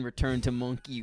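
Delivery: ordinary delivery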